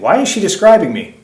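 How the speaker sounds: hammed-up puzzled reaction; attacker's puzzled thought. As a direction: act out his startled question to himself. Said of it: "You might think".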